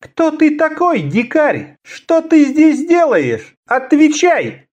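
high, slow ringing voice of a cold assassin. Acted. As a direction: ring out high and cold, slowly. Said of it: "неожиданно высоким, каким-то звенящим голосом".

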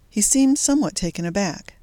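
plain reading voice

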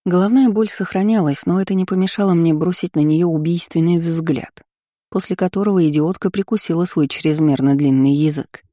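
usual speaking voice

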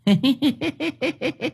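very high cackling laugh from the treetop; ghost cackle. A narrator's reading. Ghost atop the tree cackles at Thơm. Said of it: "cười khanh khách".

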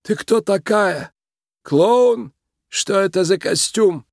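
slow, high, creaky voice with authority; a tiny boss's imperious creak, drawn out; creaky, commanding Mister Big. A narrator's reading. Mob boss confronts the uninvited guest on his daughter's wedding day, creaky and commanding. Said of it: "Скрипучий голос мистера Бига звучал властно".

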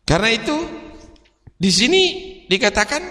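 emphatic preacher's declaration; preaching at full force. Acted. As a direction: drive the point home to the crowd.

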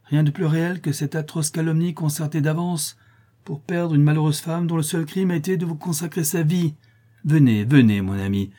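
lightly voiced haughty woman; indignant stepmother. A narrator's reading.

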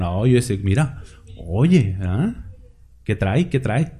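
a lightly voiced curious neighbour at the next table; mock gossiping diner. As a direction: murmur like a diner nudging a friend. Mocking diners whispering about you.

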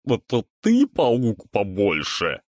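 slow mocking monster chorus; gurgling alien voice. A narrator's reading. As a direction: speak as many swamp creatures at once. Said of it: "в один голос произнесли твари".

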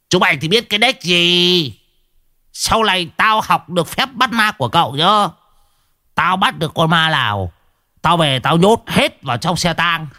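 a lightly voiced old man, full of himself; boastful old man. Old man bragging to the young master, swaggering voice.

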